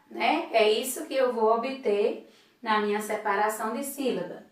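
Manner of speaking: ordinary talk, nothing marked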